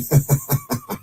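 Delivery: high chuckle breaking into the sentence; real, amused laughter. Real, not acted. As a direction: laugh at the absurdity mid-story. Amused at the man's antics.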